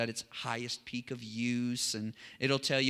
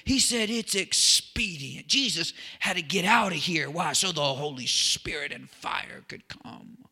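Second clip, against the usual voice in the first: worked-up preaching; 4 semitones higher, 4 dB louder than usual. Retold or imitated. Youth pastor recalls teaching it to his youth, all worked up. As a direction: re-enact getting all worked up over the verse. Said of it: "I would get, you know, all worked up".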